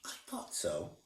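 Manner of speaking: soft whispered satisfaction; old woman quietly pleased; knowing smile. A narrator's reading. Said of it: "the old woman said with a smile".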